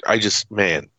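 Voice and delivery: ordinary talk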